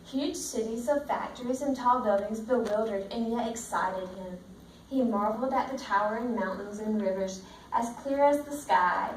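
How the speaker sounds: steady storybook read-aloud to the class; reading to children. Acted. Teacher reading the book to her class, in a steady storybook voice. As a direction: read it out like a picture book for the kids.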